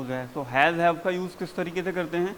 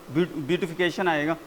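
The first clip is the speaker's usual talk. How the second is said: usual speaking voice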